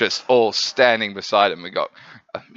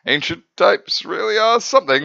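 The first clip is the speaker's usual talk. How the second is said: dry Law; 11 semitones higher, 4 dB louder than usual. Retold or imitated. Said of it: "Lord's just like".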